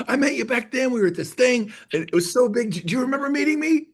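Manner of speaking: high-pitched, eager gushing; all excited. Acted. Caller reintroducing themselves to an agent met long ago, all excited. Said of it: "Call him up all excited".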